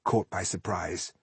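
ordinary delivery

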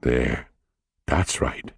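soft, low and coaxing; murmured with a smile. A narrator's reading. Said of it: "Jake's voice, very low".

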